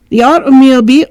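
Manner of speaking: put-on housekeeper in a high English accent; lightly English-accented servant asking. Reader voicing the old servant with an English accent. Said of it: "why am I giving her an English accent?".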